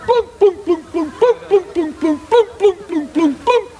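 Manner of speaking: high-pitched rhythmic "boom, boom"; sing-song high booms, like plucked strings; imitated ukulele noise. Storyteller mimics boy playing ukulele round town, high imitated plunks.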